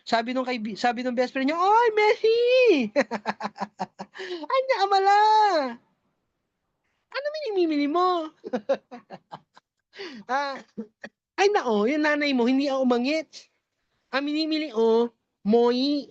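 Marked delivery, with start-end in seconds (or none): 1.6-2.4 a bright high greeting shout; calling out. Acted. Best friend greeting the customer with a high shout. 4.5-8.3 ngongo voice. Acted. Best friend in ngongo, cheerful. 11.3-16.1 ngongo voice. Acted. Friend answering in ngongo.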